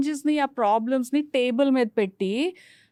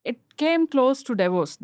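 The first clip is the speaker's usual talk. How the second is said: ordinary talk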